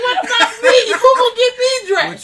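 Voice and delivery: high, shouted protest with laughter; comic indignation. Retold or imitated. Stuck daughter as dad goes to dress, loud indignant protest. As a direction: wail it in mock outrage.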